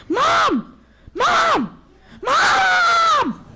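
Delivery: very high, loud calling out; frantic yell. Acted. Little wolf running into the cave, frantic high yelling. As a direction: call for mom in a panic. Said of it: "he yelled".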